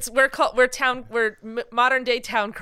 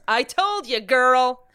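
sassy friend gloat; 4 semitones higher, 7 dB louder, slower than usual. Retold or imitated. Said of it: "where she goes".

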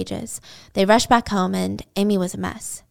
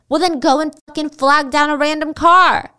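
angry; 6 semitones higher, 6 dB louder than usual. Retold or imitated. Billy ordering Jill.

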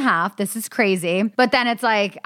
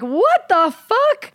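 drawn out, much higher, slow and incredulous; stunned disbelief; 13 semitones higher, 4 dB louder, much slower than usual. Retold or imitated. Hearing the doctor say nine centimeters, stunned and slow. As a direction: let the shock stretch every word.

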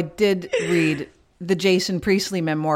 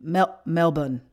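"Melbourne" in Australian English, the second syllable reduced to "bun"; Australian accent; slower than usual. Shown as a demonstration. Showing the co-host how locals say "Melbourne", Australian accent. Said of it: "That's how they pronounce it".

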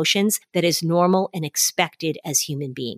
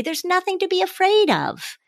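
put-on higher, brushing-off reassurance to a child; dismissive parent; 12 semitones higher than usual. Shown as a demonstration. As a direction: wave the fear away, much higher. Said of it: "we say".

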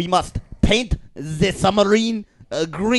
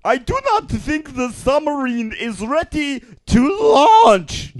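higher, slow cartoon German accent; mock German officer; 9 semitones higher, 5 dB louder than usual. Pretending to be a German U-boat crewman, high, slow mock German accent. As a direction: play a nervous German submariner. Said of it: "Herr Commander".

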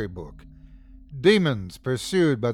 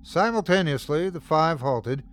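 ordinary delivery

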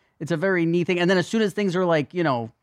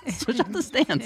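laughing; 9 semitones higher, 3 dB quieter, slower than usual. Real, not acted. Laughing at own joke.

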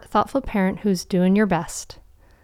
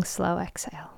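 whispered exhale cue; 12 dB quieter than usual. Acted. Breathing cue in meditation.

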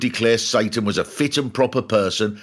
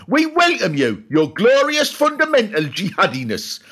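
fawning fans; 9 semitones higher than usual. Retold or imitated. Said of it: "this lot would have gone".

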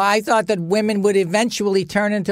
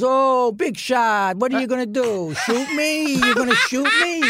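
taunting mother; 8 semitones higher than usual. Retold or imitated. Mother taunting stepfather, high and mocking. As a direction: sneer at him, daring him. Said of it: "my mother goes".

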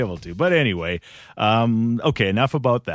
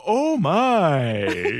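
broadcaster's oh my on request; mock commentator exclaim. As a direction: give them the oh my. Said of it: "Give me the oh my".

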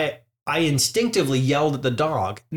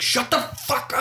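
higher, sharper mock snap; snapping at dog; 11 semitones higher than usual. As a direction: bark it at the dog, irritated. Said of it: "and he's like".

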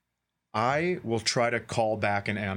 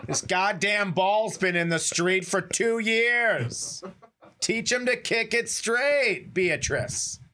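slow, higher, put-upon grumble; mock grumpy neighbor; 7 semitones higher, much slower than usual. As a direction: play the fed-up neighbor, slowly. Mock angry neighbor replying.

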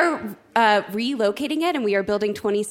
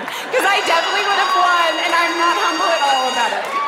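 boastful; 7 semitones higher, 4 dB louder than usual. Real, not acted. Boasting she would have won.

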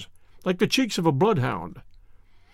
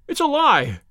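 high-pitched heated accusation; worked-up yell, not full volume; angry yell; 8 semitones higher, slower than usual. A narrator's reading. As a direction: yell it in outrage, pitch jumping up. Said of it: "he yelled".

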